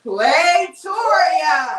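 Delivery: shouting the winner's name; excited shout. Real, not acted. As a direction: yell the name out, thrilled. Announcing the raffle winner.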